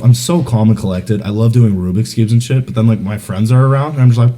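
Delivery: usual speaking voice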